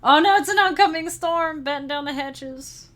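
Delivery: loud, high-pitched playful panic; hammed-up alarm at an oncoming storm; mock alarm. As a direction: cry out as if sounding a pretend storm warning. Playfully alarmed at raider's name.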